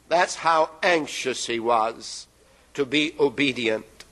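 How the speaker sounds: ordinary delivery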